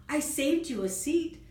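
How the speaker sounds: high, loud excited offer; eager and bubbly; excitedly. Acted. Shy tells Bubba about his seat, excited. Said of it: "said Shy excitedly".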